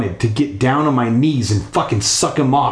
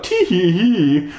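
nervous giggle; 6 semitones higher, slower than usual. Acted. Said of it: "A frightened giggle".

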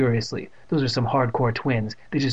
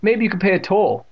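bully voice; 8 semitones higher, 5 dB louder than usual. A narrator's reading. Bully twin demanding a toll.

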